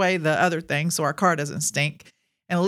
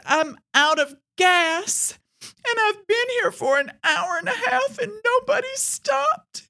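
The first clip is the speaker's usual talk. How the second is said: high, slow, teary voice; a distraught old woman pleading, crying; imitating a crying woman. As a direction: play the stranded lady sobbing. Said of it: "starts bawling".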